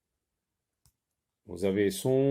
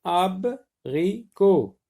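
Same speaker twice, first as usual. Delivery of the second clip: Abricot drawn out, very slow; slow dictation; 6 semitones higher, 4 dB louder, much slower than usual. Shown as a demonstration. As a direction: spell Abricot out slowly for the pupils. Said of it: "dictating the word slowly".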